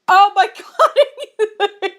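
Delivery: drawn-out, slow laughing cry. Real, not acted. Reader dying of laughter at the story, slow laughing cry. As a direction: stretch it out while laughing. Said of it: "laughing, stretched".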